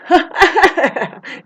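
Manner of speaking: loud, high burst of laughter; laughing. Real, not acted. Amused by the rights talk, loud high laughter. Cackles before mocking retort about guns.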